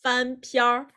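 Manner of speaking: 翻篇儿 with the last syllable curled into an R; Beijing-style R colouring on the end of 翻篇儿; Beijing erhua. Shown as a demonstration. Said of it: "And in Beijing accent".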